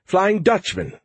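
surprised. Acted. Crew member asks incredulously.

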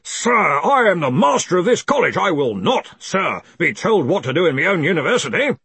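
booming, indignant master of the college; pompous outrage with hammered emphasis; sudden fury. A narrator's reading. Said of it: "in sudden fury".

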